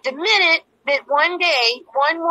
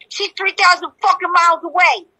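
higher-pitched mock outburst; heated imitation of the ex, pitched up; imitated irritated retort; 8 semitones higher, 4 dB louder than usual. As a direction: mimic his exasperated snap back. Acting out ex's irritated retort.

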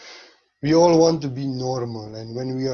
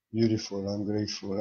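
ordinary talk, nothing marked

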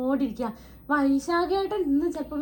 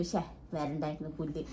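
annoyed muttering; 8 semitones lower, 8 dB quieter than usual. Retold or imitated. Private thought, annoyed regret.

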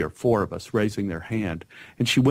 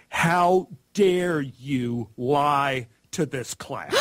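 slow, stern scolding teacher's voice; 4 semitones higher, much slower than usual. Retold or imitated. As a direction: drag each word out like an outraged teacher. Teacher scolding a child in a retold scene.

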